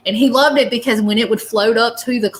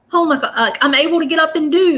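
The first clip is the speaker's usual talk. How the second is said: higher, louder burst of amazement; amazed excitement; 7 semitones higher, 3 dB louder than usual. Retold or imitated. Spirit amazed he can stand again, excited and higher. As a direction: marvel at being able to stand again.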